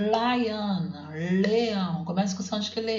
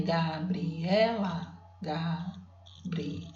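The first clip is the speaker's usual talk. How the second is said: very slow Gabriela, syllables pulled apart, falling at the end; 4 dB quieter, much slower than usual. Shown as a demonstration. Teacher counting syllables with the class, slow and separated. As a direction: spell out Gabriela by ear, one beat per syllable. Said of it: "vamos ver quantas sílabas tem?".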